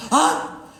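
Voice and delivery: high gasped "Up"; exaggerated high, airy "Up"; manic up. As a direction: pop UP high like a jolt of elation. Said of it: "this manic depressive situation".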